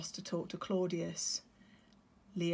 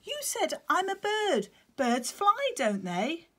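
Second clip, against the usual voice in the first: very high, loud little chick; eager chick; 11 semitones higher, 6 dB louder than usual. Acted. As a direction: argue like an eager small child. Chick Otto voiced.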